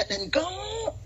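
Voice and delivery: stammer, then a high, soft drawn-out "go"; mock pulpit swoop far up in pitch; extreme preacher delivery. Showing the extremes of church style, hammed-up preacher. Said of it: "the extremes".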